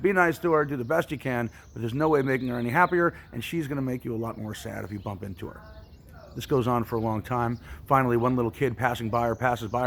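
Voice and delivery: usual speaking voice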